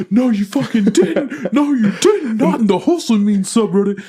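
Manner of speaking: high, drawn-out, laughing disbelief; mock outrage. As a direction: squeal it in playful indignation.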